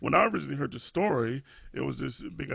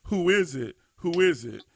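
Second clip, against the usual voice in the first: mild mock yell, a bit higher and louder; retold scream; 4 semitones higher, 5 dB louder than usual. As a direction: call it out as a restrained imitation of screaming. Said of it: "They're screaming down the hall".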